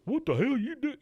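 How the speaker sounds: very high, silly parroting voice; mocking. The guy making fun of Hank, mocking. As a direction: echo it back in an exaggerated high mimic. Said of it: "starts making fun of Hank".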